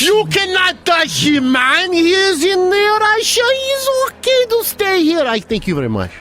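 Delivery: high, slow, shouted put-on Russian voice; mock Russian shout. Friend hams up a Russian official shouting. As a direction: bellow it like a cartoon Russian official.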